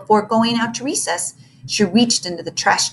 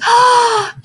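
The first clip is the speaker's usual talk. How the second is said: delighted gasp; 8 dB louder than usual. Acted. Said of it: "librarian gasps in delight".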